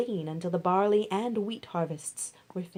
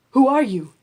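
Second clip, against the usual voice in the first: loud, higher startled cry; 6 semitones higher, 12 dB louder than usual. Acted. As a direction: jolt awake and demand it. Startled Boaz in the night.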